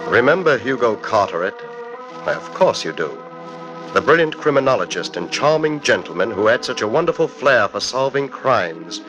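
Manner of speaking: ordinary talk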